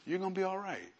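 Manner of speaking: soft, soothing promise; retold comforting. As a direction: reassure him quietly. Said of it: "I put my hand on his chest".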